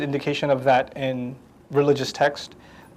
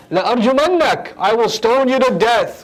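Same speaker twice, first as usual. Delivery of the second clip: stern threat; 5 semitones higher, faster than usual. Retold or imitated. Father's threat restated.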